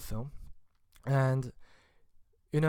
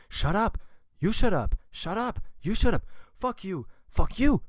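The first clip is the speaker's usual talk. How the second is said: higher and louder shouts, building to a yell; escalating shouting match; 7 semitones higher, 7 dB louder than usual. Retold or imitated. French and English moviegoers yelling insults in theater, escalating shouts. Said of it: "The French".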